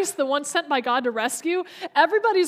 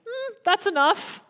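a higher, slow, sighing "Hmm, that's enough", disappointed and resigned; weary sigh; 7 semitones higher than usual. Shown as a demonstration. Preacher showing Jesus sadly resigned to the disciples, slow sighing. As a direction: let "Hmm" hang, then sigh out "that's enough" slowly. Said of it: "Or is this like".

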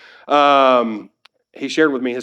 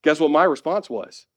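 normal talking voice

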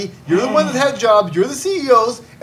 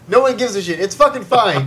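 ordinary talk

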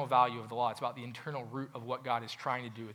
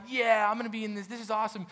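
excited attendee; 8 semitones higher, 4 dB louder than usual. Retold or imitated. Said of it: "they're like".

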